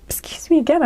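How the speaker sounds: talking through hiccups; a hiccup breaks the words. Real, not acted. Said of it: "I'm hiccuping".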